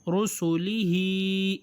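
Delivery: رسلہ drawn out very slowly; slow reading demo. Shown as a demonstration. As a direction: stretch رسلہ with a long i. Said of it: "standing کسرہ ہے".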